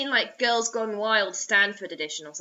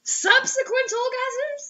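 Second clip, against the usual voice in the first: incredulous; 12 semitones higher, much slower than usual. Real, not acted. Incredulous repeat.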